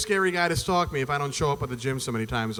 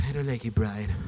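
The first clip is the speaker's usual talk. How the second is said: a lightly voiced Stewie, lower and softer, sulky; exhausted Stewie; 7 semitones lower, 6 dB quieter than usual. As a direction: mutter it like a worn-out, grumpy Stewie. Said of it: "Brian Stewie bullshit".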